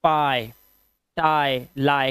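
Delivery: पाई, टाई, लाई each ending in "eye"; one at a time, clear "eye" glide on पाई and टाई. Shown as a demonstration. Teacher drilling examples, "eye" sound ending पाई, टाई, लाई. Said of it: "आई की आवाज देनी है".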